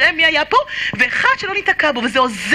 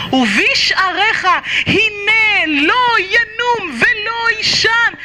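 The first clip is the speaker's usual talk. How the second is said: slow, drawn-out cry of the Shema; fervent prayer; 5 semitones higher, much slower than usual. Acted. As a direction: stretch every word out like a plea. Leads recitation of Shema passage.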